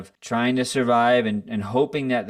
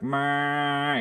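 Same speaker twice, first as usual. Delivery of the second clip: sustained higher-pitched toning drone; sung toning note; 6 semitones higher than usual. Shown as a demonstration. Describing morning harmonium practice, sung toning note. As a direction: hold one open note, higher than speech. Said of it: "we'll just tone and it's like".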